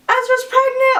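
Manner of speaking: high, loud squealed news; retold sister's thrilled cry; imitated excited announcement.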